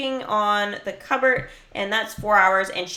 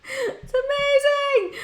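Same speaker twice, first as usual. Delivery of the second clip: laughing; slower than usual. Real, not acted. Laughing at game scene.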